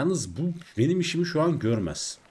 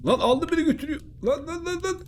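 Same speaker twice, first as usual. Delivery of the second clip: panicked yelling. Real, not acted. Gamer yells while a shark drags his character away, real high panic. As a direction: yelp in real panic as you're dragged off.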